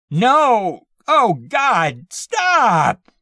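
high, loud desperate screams; screaming near tears. A narrator's reading. Pinned man about to burst into tears, screaming. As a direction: beg in sheer terror. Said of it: "He burst into tears".

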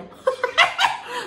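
high, loud laugh; helpless high laugh at being stuck; burst of laughter. Real, not acted. Laughs at her own confusion.

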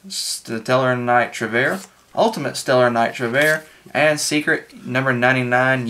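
normal talking voice

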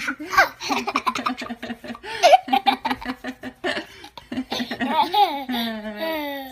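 loud delighted laughter; hearty laugh. Real, not acted. Grandma laughing at toddler.

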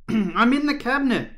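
high, loud holler; calling from hiding. A narrator's reading. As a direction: shout so searchers find you. Calling from inside the cabinet.

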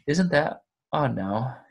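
ordinary delivery

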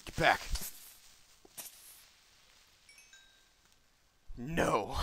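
loud, urgent yell; real alarmed yelling; alarmed shout. Real, not acted. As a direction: shout it in sudden panic. Fending off a game attacker.

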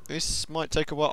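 ordinary talk, nothing marked

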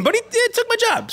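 very high, quick comic whine; hammed-up squeaky protest; mock aggrieved. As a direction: squeal the complaint as a joke. Comic complaint about his replacement.

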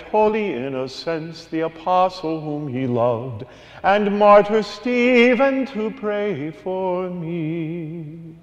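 normal talking voice

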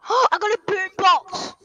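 delighted at the loot; real gleeful discovery; excited gasp. Real, not acted. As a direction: gasp then loud thrilled exclaim. Gasps at new item.

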